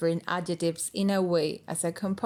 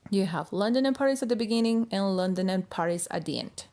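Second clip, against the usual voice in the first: ordinary talk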